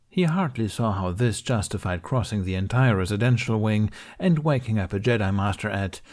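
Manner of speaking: usual speaking voice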